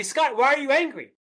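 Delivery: hammed-up accusing coworker; mock accusation. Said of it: "People will say to me".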